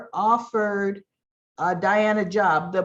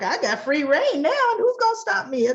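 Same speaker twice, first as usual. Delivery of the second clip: gloating; 11 semitones higher than usual. Retold or imitated. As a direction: crow about having no limits, hammed-up. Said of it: "Phyllis is kinda like".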